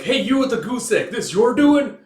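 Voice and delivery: high, loud shouting at the dazed man; angry challenge. Retold or imitated. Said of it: "He's like".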